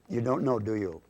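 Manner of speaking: ordinary delivery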